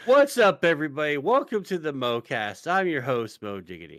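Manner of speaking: upbeat host welcome, punchy start; podcast host intro. Acted. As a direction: open the show like a host. Host opens the show.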